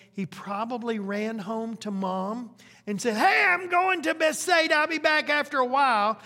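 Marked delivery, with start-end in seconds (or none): 3.2-6.2 put-on high, loud boy shouting to mom on his way out; a kid yelling from the door, in a hurry; excited kid calling out.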